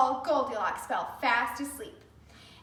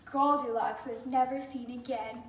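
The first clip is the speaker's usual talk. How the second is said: plain reading voice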